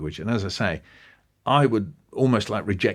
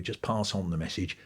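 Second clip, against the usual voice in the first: normal talking voice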